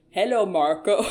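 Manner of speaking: lightly imitated Swedish or Swiss accent; mock Swedish accent. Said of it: "He says it in his accent".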